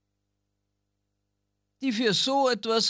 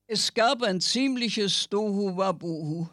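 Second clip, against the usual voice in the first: ordinary delivery, nothing marked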